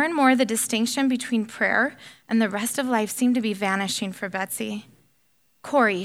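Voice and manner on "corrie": normal talking voice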